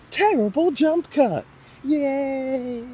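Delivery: very high, drawn-out singsong; mock cheer. Announcing a jump cut to viewers, high mock cheer. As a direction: stretch it out high like a silly cheer.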